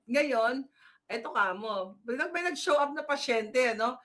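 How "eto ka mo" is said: ordinary delivery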